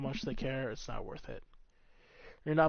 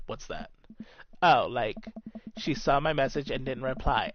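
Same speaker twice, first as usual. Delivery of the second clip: usual speaking voice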